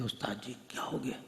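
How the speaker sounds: hushed worried student's question; quiet. Retold or imitated. Worried student asks his teacher quietly, hushed. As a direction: ask softly and anxiously, afraid of the teacher. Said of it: "آہستہ سے کہا".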